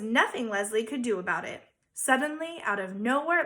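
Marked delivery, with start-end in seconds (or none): none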